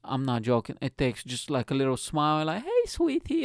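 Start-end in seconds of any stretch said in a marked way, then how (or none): 2.6-3.4 high falsetto come-on; mock sweet-talker; flirty woman. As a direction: imitate a woman flirting, pitched way up.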